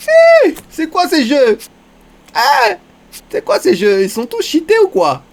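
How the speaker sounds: loud, high, indignant yell; outraged shout. Real, not acted. Streamer yelling at cheating poker players, loud and high. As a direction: shout your disbelief at the table.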